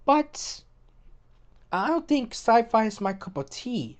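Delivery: normal talking voice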